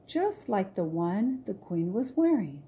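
ordinary delivery, nothing marked

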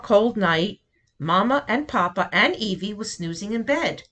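plain reading voice

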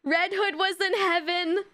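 high, wailing "no" into anxious whine; high-pitched fretting; distressed protest. Real, not acted. Upset on seeing the nature artwork.

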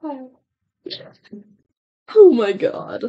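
usual speaking voice

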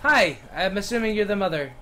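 normal talking voice

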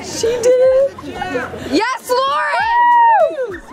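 high, slow triumphant cheer; excited cheering. Real, not acted. Friend completes the plunge.

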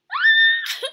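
loud, very high shriek; startled scream. Real, not acted. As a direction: shriek as the monster appears. Startled during scary game.

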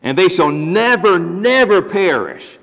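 both nevers slowed and lifted, never, never perish; stress on never. Shown as a demonstration. Pastor stressing the doubled never to his congregation. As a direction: lean hard on each never, drawn out. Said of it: "it's emphasized with a double negative".

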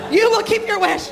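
giggling fake-stern mom; laughing mock order. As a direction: insist while cracking up. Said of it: "psycho mother".

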